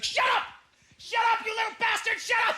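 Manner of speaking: high-pitched mock frantic yelling; losing her mind. Mother of ten yelling in Walmart, losing her mind. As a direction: imitate a frazzled mother screaming at her kids. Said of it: "She's just losing her mind".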